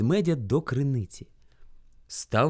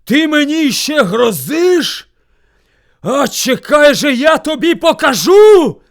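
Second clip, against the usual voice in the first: very loud, high, drawn-out threat; furious bear cry; 11 semitones higher, 12 dB louder, slower than usual. A narrator's reading. Bear threatens his echo, enraged shout. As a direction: yell slowly with mounting fury. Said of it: "скрикнув ведмідь".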